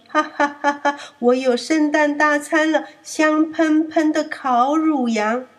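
wolf drooling over his feast; hammed-up villain gloat. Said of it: "一边流口水，".

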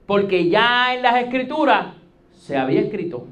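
ordinary talk, nothing marked

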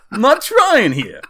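high, loud cartoon Jersey voice; Jersey accent. Storyteller defends his only voice, hammed-up Jersey accent. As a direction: protest in an exaggerated Jersey accent. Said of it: "This is the only Jersey voice I know".

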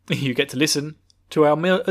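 ordinary talk

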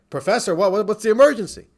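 loud, high, urgent questioning; alarmed rabbi. Retold or imitated. Rabbi answering professor's late-night collect call, alarmed. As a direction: imitate someone startled by a late emergency call.

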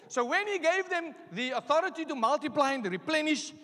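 usual speaking voice

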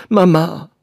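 high, loud exclamation; repeated cry. A narrator's reading. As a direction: cry out in alarm at the thought. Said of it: "rief sie abermals".

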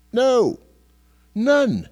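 loud, high preacher's punch; emphatic preaching. Acted. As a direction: hammer each word home. Preacher hammering a point.

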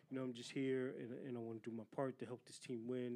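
low, subdued mock interview voice; press-conference quarterback. Host mocking the quarterback's press conference, low and subdued imitation. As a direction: mimic a bland, quiet athlete quote. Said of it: "at the press conference talking about".

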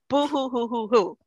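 mock boo-hoo sobbing; hammed-up fake crying. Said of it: "let me try this tactic".

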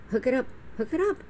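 eager hook it up. Retold or imitated. Excited reply to garage dentist offer.

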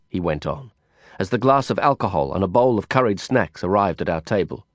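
ordinary delivery, nothing marked

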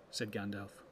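usual speaking voice

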